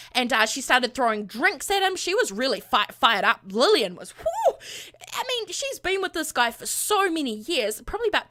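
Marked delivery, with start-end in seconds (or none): 4.2-4.5 sudden high whoop, mock hype; a quick high whoo of excitement; excited whoop. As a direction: yelp way up high like she's fired up. Describing Lillian fired up.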